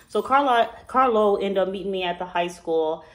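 normal talking voice

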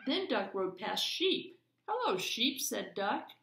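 put-on lively read-aloud for children; story narration. A narrator's reading. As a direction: read it like a bedtime picture book. Reads picture book aloud.

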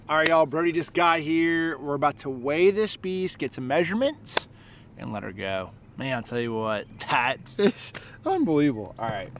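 ordinary talk, nothing marked

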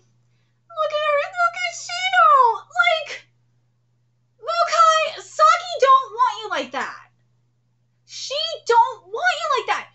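high, loud gushing; excited squealing. Real, not acted. Fan squealing at Shino's confession. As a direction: gush over the scene.

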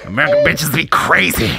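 stand-up punchline voice; Dave Chappelle impression. Comic delivering the punchline, American stand-up impression. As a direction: land it like a touring comic. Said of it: "It legit feel like I'm in the room with".

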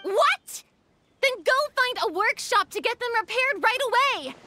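high, alarmed director giving urgent orders; frantic, exasperated command; exasperated outburst. Acted. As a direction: burst out in high-pitched disbelief, then order them off. Director learns no spare camera exists.

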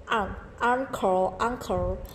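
uncle with a short clipped a, said slowly; teacher drilling the short a in uncle; short a sound. Shown as a demonstration. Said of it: "A ngắn này".

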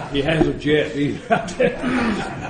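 chuckling through the words, slowed right down; laughing. Real, not acted. Telling the crowd he never paid, laughing. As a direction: laugh at your own joke as you say it. Joke about unpaid coffee.

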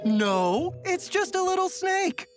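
usual speaking voice

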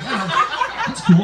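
high, falsely easygoing voice; barely holding it together. Retold or imitated. Boyfriend swallowing his anger at girlfriend, mock fake calm. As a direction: pretend it's fine while losing it. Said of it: "I'm losing it".

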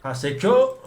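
normal talking voice

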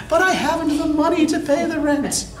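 high, pleading damsel voice; damsel in distress. Acted. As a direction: wail it as the helpless heroine, high-pitched. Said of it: "a young heroine".

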